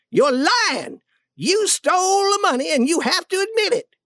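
high-pitched, forceful demand; acted angry outburst; furious accusation. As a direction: press hard for a confession. Duke accuses and demands confession.

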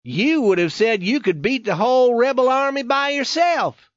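high, emphatic challenge; daring. A narrator's reading. Youth reminding friend of his old boasting, with daring. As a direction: throw his boast back at him boldly. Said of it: "he finally replied with daring".

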